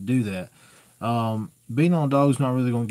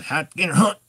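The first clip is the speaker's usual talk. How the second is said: higher, louder stern order with the pitch rising on "hunt"; mad command; 5 semitones higher, 4 dB louder than usual. Shown as a demonstration. Owner ordering a stubborn hound to hunt, stern and louder. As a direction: bark it out like a dad who's had enough. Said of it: "Okay, Dad's mad".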